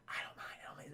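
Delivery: whispered, offhand. Retold or imitated. Perseus brushes off the curse in a whisper. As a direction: breathe it out casually.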